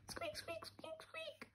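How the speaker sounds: very high, tiny breathless squeaks; mouse squeaks. Acted. Skeleton mouse at the zoo.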